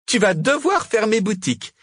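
high-pitched gloating witch; radiant triumph. A narrator's reading. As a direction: crow over your beaten rival. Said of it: "répondit Margot rayonnante".

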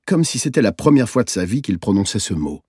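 ordinary delivery

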